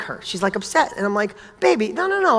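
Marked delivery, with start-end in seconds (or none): 1.6-2.4 put-on high, tender reassurance to a crying child; soothing dad. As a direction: hush her gently, pitched up. Dad comforting crying daughter.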